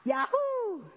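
high, loud whoop of joy; excited cry. Acted. Little cub cheering to Mama, high excited whoop. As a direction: let the cub whoop with delight. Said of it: "cried little cub".

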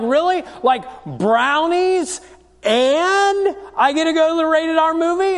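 put-on surprised teen. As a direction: play the son who can't believe his luck. Teen son stunned by mom's offer.

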